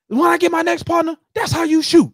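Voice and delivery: high, loud movie-hero line; mock action-movie cop. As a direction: ham up a cop bragging to his partner.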